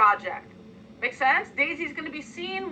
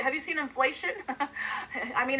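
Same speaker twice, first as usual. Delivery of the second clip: ordinary talk, nothing marked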